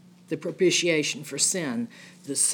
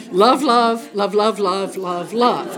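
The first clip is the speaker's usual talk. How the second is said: playful chant of love; laughing mock repetition; 3 semitones higher, 6 dB louder, slower than usual. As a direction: hammer the word love over and over, amused. A very repetitive book.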